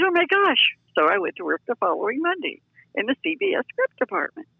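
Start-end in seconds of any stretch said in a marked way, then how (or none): 0.0-0.7 amazed. Retold or imitated. Retold reaction to job offer.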